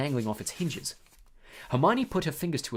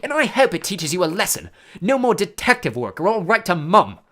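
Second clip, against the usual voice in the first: loud, stern, higher warning; terse; 5 semitones higher, 9 dB louder than usual. A narrator's reading. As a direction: lay down the threat firmly. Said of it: "Percy said tersely".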